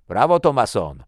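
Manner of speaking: high, very loud cheer; loud congratulatory yell; shouted bravo. A narrator's reading. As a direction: call out hearty praise from afar. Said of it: "krzyknął Svensson".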